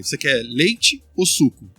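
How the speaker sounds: slow, clearly offered choice; asking the little boy slowly, leite or suco; slow question to child. Retold or imitated. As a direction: ask it slowly so a small child follows. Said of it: "A gente fazia uma pergunta pra ele".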